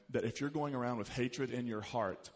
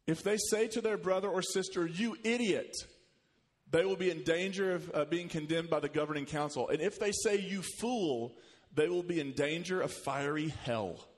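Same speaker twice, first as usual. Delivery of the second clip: fiery preaching; 4 semitones higher than usual. Acted. Pastor reading Jesus' words on anger to the congregation, preaching hard. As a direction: preach the verse as a stern warning.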